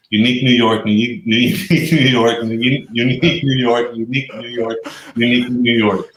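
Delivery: tongue twister attempt. Real, not acted. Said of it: "Let's see if you can do it".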